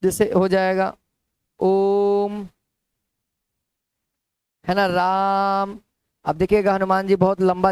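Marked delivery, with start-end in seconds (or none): 1.6-5.8 slow, elongated demo of ओम and राम; prolonged vowel. Shown as a demonstration. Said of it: "बहुत ज्यादा लंबा समय तक खींचा जाता है".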